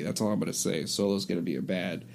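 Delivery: ordinary talk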